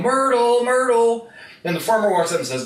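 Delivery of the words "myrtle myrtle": high, loud searching call. Acted. Old farmer calling for his missing pet goat, calling out. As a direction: holler for a lost pet, acted.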